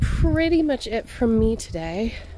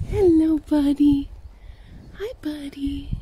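squeaky praising a dog; 6 semitones higher, slower than usual. Real, not acted.

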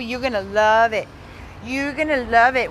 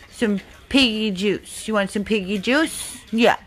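ordinary delivery

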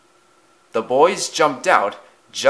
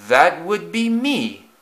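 smug owning-up; 7 semitones higher, slower than usual. A narrator's reading. Henry owns up to his trick.

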